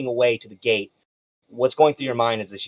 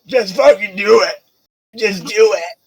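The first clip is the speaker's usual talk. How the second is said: high, loud goading orc voice; jeering orc spectator, higher and louder; orc guy urging; 8 semitones higher, 5 dB louder than usual. Acted. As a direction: egg him on like a rough little orc. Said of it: "The little orc guy goes".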